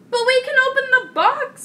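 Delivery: high, loud protesting toad; objecting toad. A narrator's reading. Toad pointing out the flaw in Frog's plan, objecting.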